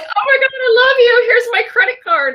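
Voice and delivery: high tearful cry of joy, nearly shouting; tearful gushing client. Retold or imitated. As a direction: play the client overcome, gushing through tears. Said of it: "And she's like".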